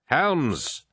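high-pitched one-word command; sharp order. A narrator's reading. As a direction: give a brisk military order. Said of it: "Abaddon ordered".

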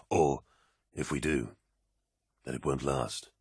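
low, sombre space marine; quiet, heavy soldier; deep brooding warrior. Acted. Loken's voice, sombre.

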